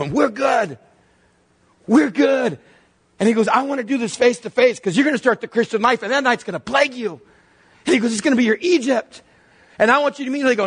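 high, emphatic pulpit voice at full drive; impassioned preaching. Acted. Preacher at peak, voicing Jesus.

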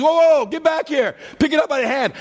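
God yelling after a fleeing Moses; calling after him. Acted. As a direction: shout him back from a distance.

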